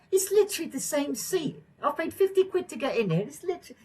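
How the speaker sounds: high squeaky outraged voice; mock outraged agent. Acting bungling agent's complaint.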